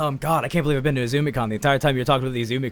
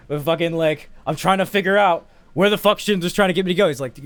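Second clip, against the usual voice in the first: usual speaking voice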